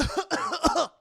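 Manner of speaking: loud sputtering fake coughs; mock drowning cough. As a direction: cough as if coming round from drowning.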